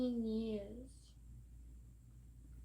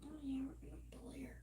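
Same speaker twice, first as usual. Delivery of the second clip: quick conspiratorial whisper; mock bully whisper; 4 semitones higher, 7 dB quieter, faster than usual. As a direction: hiss the plan under your breath. Voicing bullies plotting against her.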